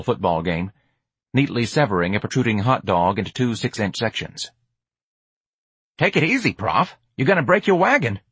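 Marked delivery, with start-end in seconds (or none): 6.0-8.2 put-on higher street-kid voice; streetwise hustler, cautioning. George tells Prof to take it easy, street voice. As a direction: pitch it up, casual alarm.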